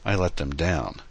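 usual speaking voice

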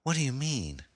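high, puzzled woman's question; woman asks her captor, questioning and scared. Acted. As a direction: ask it high and unsure. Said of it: "looked up into his eyes questioningly".

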